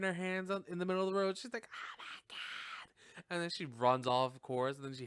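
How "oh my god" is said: breathless whispered gasp; mock whispered horror; whispered oh my God. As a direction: whisper it in slow shock. Voicing Sydney in road.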